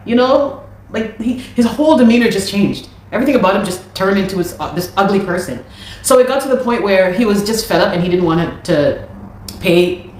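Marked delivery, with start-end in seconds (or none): none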